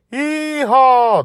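very high, loud whoop; long, piercing cry of glee; gleeful yell. A narrator's reading. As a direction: holler it like riding a bucking dragon. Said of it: "Dragon Little yelled when I spun around".

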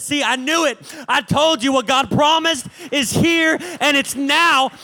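loud, high, slow shouted preaching; preaching at full force. Acted. Preacher proclaiming God's promise to the congregation, shouting.

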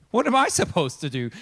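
mock exasperation at an impossible request; upset king. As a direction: act out a flustered king. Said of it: "he gets really upset".

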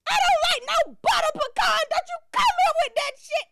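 high, loud fussy aunt; indignant auntie. Retold or imitated. Aunt complaining to daughter, high fussy voice. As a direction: sniff at the flavor.

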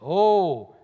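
loud, drawn-out mock moan. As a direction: moan it out in theatrical dismay. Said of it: "he moaned".

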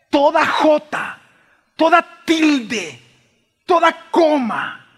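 slow, punched "jota", "tilde" and "coma"; emphatic proclamation. Real, not acted. Preacher assures the church, "jota", "tilde", "coma" drawn out forcefully. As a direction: hammer out "jota", "tilde", "coma" one at a time. Sudden emphatic swell amid hush.

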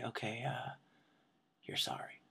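quiet musing. Retold or imitated. Mom mulling quietly.

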